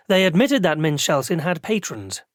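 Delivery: ordinary delivery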